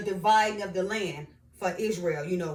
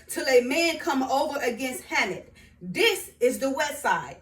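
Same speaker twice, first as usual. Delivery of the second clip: raised, louder Bible recitation; scripture reading; 4 semitones higher, 5 dB louder than usual. Acted. Reading Israel's borders to viewers, scripture reading. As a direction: proclaim each border firmly, phrase by phrase.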